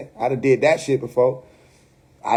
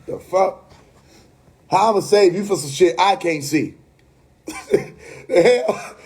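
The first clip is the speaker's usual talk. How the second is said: normal talking voice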